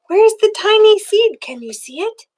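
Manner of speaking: usual speaking voice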